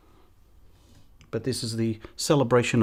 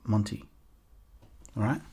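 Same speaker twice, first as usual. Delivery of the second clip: normal talking voice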